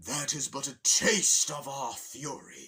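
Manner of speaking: slow, loud, pompous menace; grand villain threat. Retold or imitated. Penguin leader warning Bowser, slow loud pompous threat. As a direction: declaim it like a warlord.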